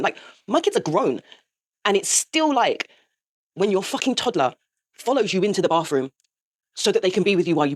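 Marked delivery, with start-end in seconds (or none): none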